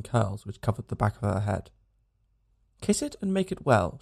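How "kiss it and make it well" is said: put-on high child's voice, perfectly serious; a small girl asking earnestly for a kiss; solemn child's voice; grave little girl. A narrator's reading. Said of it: "she said, with perfect gravity".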